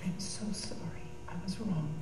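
whispered, near-silent plea for forgiveness; hushed confession. Retold or imitated. Speaker retelling her prayer to God, softly whispered. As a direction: breathe the apology barely voiced, head bowed.

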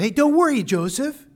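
high, loud call down to someone below; cheery yelled reassurance. Acted. Bystander calling down to Joseph in the pit, yelling. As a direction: holler a breezy don't-worry, pitched well up. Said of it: "yells down to Joseph".

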